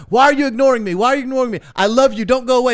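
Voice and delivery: high, loud mock pleading; frantic pleading. Scammer begs the man who ignores her, mock frantic. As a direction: imitate a desperate, clingy sweetheart.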